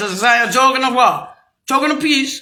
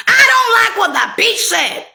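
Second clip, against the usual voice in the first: mock offended quote; 8 semitones higher than usual. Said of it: "quoting critics' reaction".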